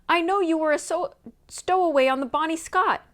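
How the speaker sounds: loud, high call chasing someone; calling after. A narrator's reading. Nancy calling after the fleeing sailor, loud and high. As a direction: call out across the distance. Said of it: "Nancy called after him".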